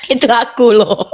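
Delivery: real laughter trailing into speech; laughing. Real, not acted. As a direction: laugh it out, then comment. Laughing after punchline.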